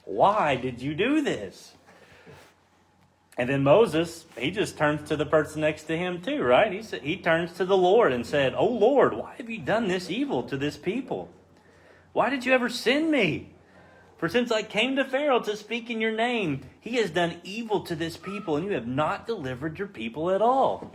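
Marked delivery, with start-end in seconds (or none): 0.0-1.7 slow, drawn-out mock complaint; upset. Israelites blaming Moses for making their lives worse, acted upset. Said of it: "they get upset 'cause they're like". 8.6-20.9 high-pitched, hammed-up protest; pleading complaint. Moses turns to the Lord over his failed mission, high-pitched pleading complaint.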